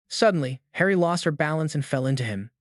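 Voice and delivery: ordinary delivery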